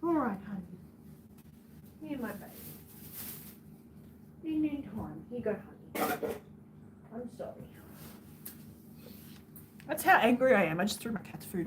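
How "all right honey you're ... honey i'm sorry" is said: soft, hushed soothing to a little one. Real, not acted. Comforting her baby quietly while it fusses.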